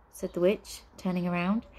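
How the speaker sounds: ordinary delivery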